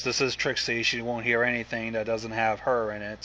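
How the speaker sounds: plain reading voice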